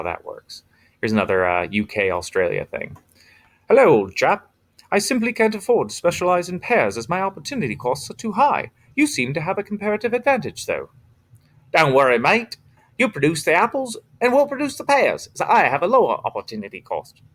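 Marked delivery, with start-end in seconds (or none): 3.7-10.9 hammed-up posh British accent, pitched high; a jolly old chap in a put-on British accent, high and bright; bad British accent. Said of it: "my horrible British and Australian accents". 11.7-17.2 hammed-up Australian accent, jumping up high; a cheery mate in a put-on Australian accent; bad Australian accent. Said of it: "my horrible British and Australian accents".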